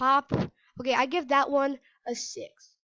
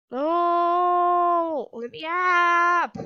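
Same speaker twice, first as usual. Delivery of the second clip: yelled plea, higher and louder; loud desperate shout; 5 semitones higher, 10 dB louder, much slower than usual. Real, not acted. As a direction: shout to be let up. Trapped under car.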